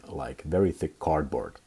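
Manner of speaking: ordinary delivery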